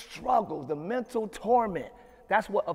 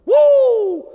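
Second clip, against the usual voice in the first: loud, high whoop; celebratory whoop; 15 dB louder than usual. Real, not acted. Pastor whooping over overcomer's blood, joyful loud whoop. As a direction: let out a joyful shout.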